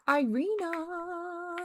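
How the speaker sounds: long, high drawn-out call. Real, not acted. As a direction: stretch the name as you call. Calling the maid again, drawn out.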